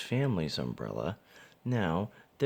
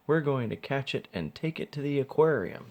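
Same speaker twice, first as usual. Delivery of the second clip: eager attendant; 8 semitones higher, 3 dB louder than usual. A narrator's reading. Attendant explains plan.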